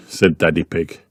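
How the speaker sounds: ordinary delivery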